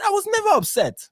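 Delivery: squeaky high mock of his denial. Host mocks Bill's denial in a high squeak. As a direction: pitch it way up, mocking the claim. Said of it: "he said".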